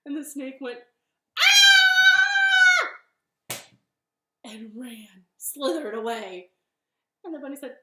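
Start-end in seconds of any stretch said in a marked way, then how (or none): 1.4-3.0 long high shriek of fright; piercingly high acted "aah"; terrified scream. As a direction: let out a loud sustained storybook scream. Snake screams at Gruffalo.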